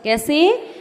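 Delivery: ordinary talk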